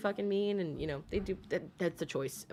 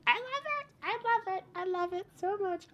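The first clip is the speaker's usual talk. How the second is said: very high, giddy squeal; excited gushing; 12 semitones higher than usual. Real, not acted. Streamer gushing over viewers from all over, high excited squeal. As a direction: squeal it in delight.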